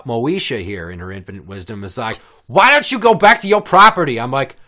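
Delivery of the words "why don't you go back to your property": high, very loud put-on voice; hammed-up shouted order; mock bossy guest. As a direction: holler the demand like an irate woman. Mimicking guest's demand.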